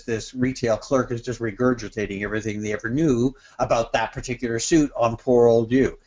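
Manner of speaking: ordinary delivery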